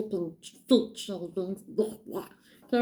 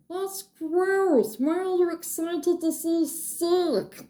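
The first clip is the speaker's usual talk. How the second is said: much higher, grossed-out squeal; disgusted; 9 semitones higher, slower than usual. Real, not acted. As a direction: recoil at the gross idea, pitched way up. Listener reacts to sick talk.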